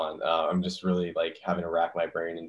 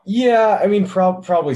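loud outburst; 13 semitones higher, 11 dB louder, slower than usual. Real, not acted. Bursts out after her teasing.

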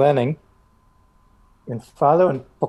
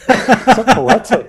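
high, loud, slow teasing through laughter; laughing mock praise; 11 semitones higher, 11 dB louder, much slower than usual. Real, not acted. Friend teasing the Swedish pickup line, laughing mock praise. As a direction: drawl it out, cracking up.

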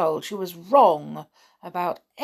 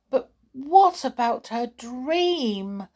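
slow, higher-pitched plea; pleading; 5 semitones higher, 4 dB louder, slower than usual. A narrator's reading. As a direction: plead for her, drawing it out. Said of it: "pleaded Tom".

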